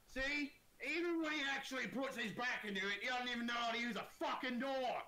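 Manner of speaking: high, loud, scornful orc shout; jeering orc. Acted. Orcs looking down at the gate-breaker, loud high jeer. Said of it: "They look down at you and go".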